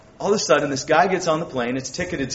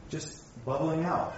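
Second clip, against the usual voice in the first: usual speaking voice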